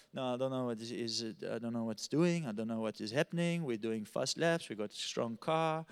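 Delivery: put-on low, subdued German accent; baffled German driver, low and soft. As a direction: grumble quietly with a German accent. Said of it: "That's my German".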